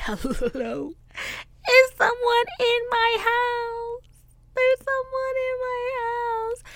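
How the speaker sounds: high, frightened squeak to the police; scared whisper-call. Retold or imitated. Scared caller to police, high frightened squeak. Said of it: "and I say".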